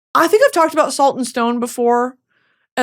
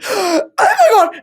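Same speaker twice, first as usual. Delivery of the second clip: retold shocked gasp; 12 semitones higher, 4 dB louder, slower than usual. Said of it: "And I said, gasps".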